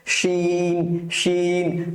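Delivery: "শিন" with sh churning inside the mouth, ee held long; slow, stretched "শিন" with a full sh; mouth-filling SH. Shown as a demonstration. Teacher demonstrating to learners, full drawn-out "শিন". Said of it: "মুখের ভেতরে আওয়াজে এরকম মারামারি করতে থাকবে".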